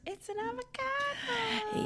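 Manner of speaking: put-on squeaky high kid voice; high excited child. Imitating kid thrilled with avocado.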